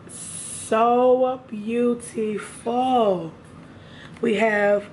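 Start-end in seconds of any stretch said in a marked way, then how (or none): 0.1-3.3 slow, drawn-out admiring sigh. Real, not acted. Vlogger gushing over palette colors, slow admiring. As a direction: savor each word in awe.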